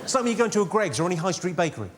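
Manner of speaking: normal talking voice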